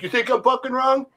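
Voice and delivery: high-pitched challenge to the crowd; indignant comedian. Retold or imitated. Comedian challenging a yelling audience, indignant. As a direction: throw it back at them, pitched high. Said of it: "And he's like".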